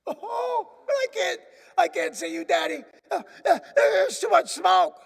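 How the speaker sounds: put-on high, loud, panicked boy's cry; frightened boy who can't see; scared child. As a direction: shout back through the smoke, terrified. Trapped boy answering father.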